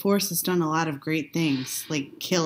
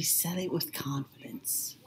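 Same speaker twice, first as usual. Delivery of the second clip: whispering; 9 dB quieter, slower than usual. Real, not acted. Wry aside while watching.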